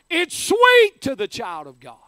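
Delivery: preacher's shouted cry of triumph; shouted proclamation. Acted. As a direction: shout it to the rafters, leaning on sweet.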